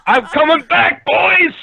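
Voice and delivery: excited. Real, not acted. Triumphant call.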